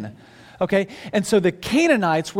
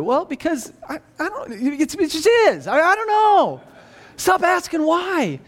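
high-pitched, stammering loss of patience; flustered, exasperated parent; 7 semitones higher than usual. Acted. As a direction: run out of answers and give up, exasperated. Parent worn down by child's questions.

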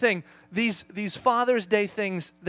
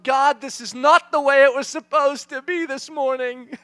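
loud, higher, slow protest to God; exasperated complaint; 6 semitones higher, 9 dB louder than usual. Retold or imitated. Dad in a chaotic shower complaining to God, exasperated. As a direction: complain upward, leaning on each word. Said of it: "remember consciously thinking to myself".

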